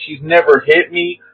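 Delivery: normal talking voice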